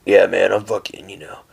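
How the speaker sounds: low, soft menacing bro voice sinking into a whisper; tough-guy bro. Retold or imitated. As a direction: play a macho guy trying to sound dangerous. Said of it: "someone who's like this fucking".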